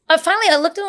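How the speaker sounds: usual speaking voice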